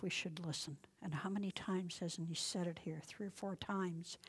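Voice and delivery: ordinary talk, nothing marked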